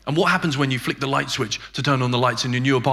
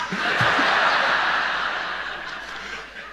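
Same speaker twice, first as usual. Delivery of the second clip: breathy high laugh; airy laugh. Retold or imitated. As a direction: wheeze with laughter. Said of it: "he started laughing".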